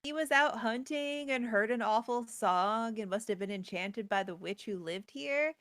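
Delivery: ordinary talk, nothing marked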